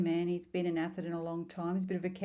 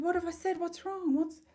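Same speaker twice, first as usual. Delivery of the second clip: much higher, anxious questioning; worried, high-pitched retold questions; retold alarm; 10 semitones higher than usual. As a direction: re-enact being taken aback, pitch jumping up. Retold alarm at daughter's tears.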